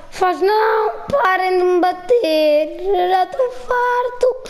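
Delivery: high whining yell, climbing as it goes; exasperated wail. Real, not acted. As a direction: wail that you've had enough. Fed up with being hit.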